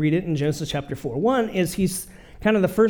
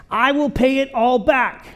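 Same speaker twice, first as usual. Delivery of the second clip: pleading servant; 7 semitones higher, 4 dB louder than usual. Acted. Servant pleading in retold parable.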